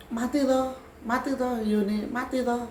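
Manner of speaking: high-pitched mocking singsong jeer; imitated taunting. Spirit mocking the teller over a dead flame, high taunt. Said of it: "ngetawain saya".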